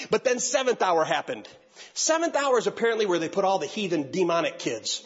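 normal talking voice

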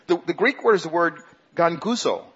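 normal talking voice

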